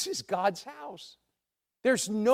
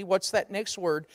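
ordinary talk

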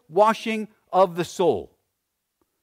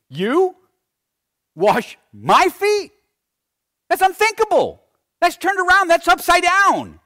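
high, loud, appalled disbelief; scandalized, building from a stunned question to a high shout; incredulous outrage; 13 semitones higher, 6 dB louder than usual. Acted. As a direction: act out Peter's shocked protest, voice shooting up. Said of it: "it falls really hard on thou and mine".

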